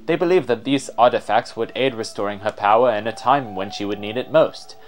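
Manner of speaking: normal talking voice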